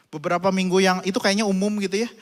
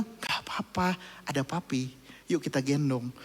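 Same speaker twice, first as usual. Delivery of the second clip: much softer, lower, tender; reassuring father; 4 semitones lower, 9 dB quieter than usual. Retold or imitated. Father reassures his scared son, soft and tender. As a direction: hush him like a gentle dad.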